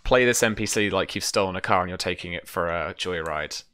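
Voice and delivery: normal talking voice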